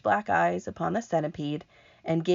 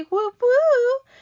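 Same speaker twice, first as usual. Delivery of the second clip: cheer; 9 dB louder than usual. Acted. Teacher cheering right answer.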